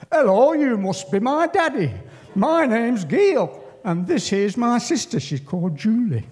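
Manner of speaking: put-on high, loud little girl in a rough English accent; Gail, rough English city accent. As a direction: pipe up as a small child with a rough city accent. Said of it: "that has a real rough accent".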